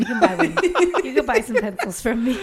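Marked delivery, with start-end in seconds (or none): 0.0-1.0 giggling as you say it; laughing repeat. Retold or imitated. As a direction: echo the quote through laughter.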